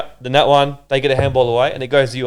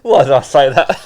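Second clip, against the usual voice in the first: laughing; 2 semitones higher, 9 dB louder than usual. Real, not acted. Laughing fit after joke.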